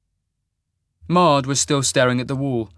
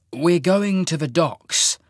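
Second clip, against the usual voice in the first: slow, higher announcement; announced; 6 semitones higher, slower than usual. A narrator's reading. Half-mage tells his lover the plan, slow announcement. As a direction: announce it slowly, deliberately. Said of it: "he announced".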